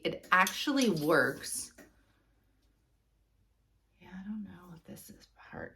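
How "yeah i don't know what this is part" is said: whispering. Real, not acted. Quiet aside while inspecting.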